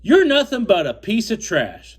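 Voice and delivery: high, loud quoted insult. Retold or imitated. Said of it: "And he says".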